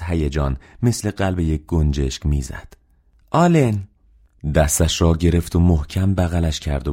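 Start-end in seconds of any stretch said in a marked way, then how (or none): 3.3-3.9 high, delighted call; thrilled acted shout of welcome; excited greeting. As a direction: cry the name out in sisterly excitement. Sister rushes excitedly to brother.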